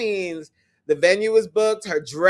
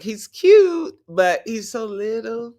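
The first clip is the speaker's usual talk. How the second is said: teasing; 4 semitones higher, 4 dB louder, slower than usual. Retold or imitated. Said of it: "So I'm like".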